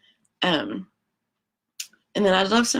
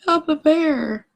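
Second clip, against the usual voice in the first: higher, slow, hammed-up cry; mock camper scream; 8 semitones higher, 4 dB louder, much slower than usual. Campers screaming at the bear, higher mock cry. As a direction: wail it like a frightened camper. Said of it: "makes the campers scream".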